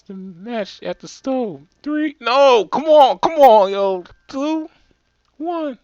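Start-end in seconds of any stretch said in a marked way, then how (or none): 1.8-4.0 high, loud hype yells; loud high hype shouting; excited shouting. Acted. Countdown to tossing match. 4.3-5.8 high, pleased, bragging voice; high gleeful bragging; gleeful countdown. Acted. Countdown, boasting.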